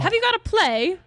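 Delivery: high British question; British accent. Acted. Son asking mother about her comeback, high British accent. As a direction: pipe up in stage British. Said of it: "we can do British".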